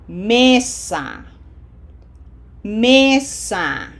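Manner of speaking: "Mesa" with a long, strong first syllable; stress on second-last syllable. Shown as a demonstration. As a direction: push "ME" in "Mesa", then let "sa" fall. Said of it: "P E two".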